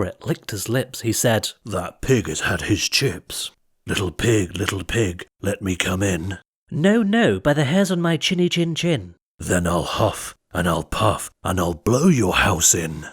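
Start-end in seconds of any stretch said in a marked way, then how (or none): 1.6-6.4 sly wolf. Acted. Wolf coaxing the pig. 6.7-9.1 high, indignant piggy voice; little pig refusing. Acted. 9.4-13.1 low menacing wolf voice; threatening wolf. Acted.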